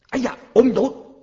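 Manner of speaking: high-pitched mock exclamation; acted dismay. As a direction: blurt it out as if caught out. Acting startled habitual Buddha exclamation. (假作惊一下喊出来)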